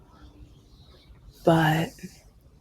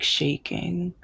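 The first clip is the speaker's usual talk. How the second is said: normal talking voice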